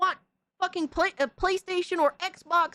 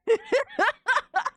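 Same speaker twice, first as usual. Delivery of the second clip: burst of laughter; 11 semitones higher than usual. Real, not acted. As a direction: crack up laughing.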